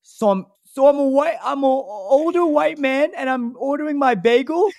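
high, badly done Italian voice; attempted Italian accent. Re-enacting the bagel guy, high Italian accent. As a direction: put on a squeaky mock-Italian accent. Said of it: "that was my Italian voice".

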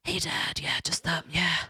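whispered, small and sheepish; guilty whisper. Retold or imitated. Girl phoning her dad after lying, guilty whisper. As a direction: whisper it like you're caught. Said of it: "I was like".

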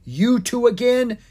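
loud, high exasperated shout. A narrator's reading. Grumpy wizard spotting the two dragons, shouting. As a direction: shout it at unwelcome visitors. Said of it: "he shouted".

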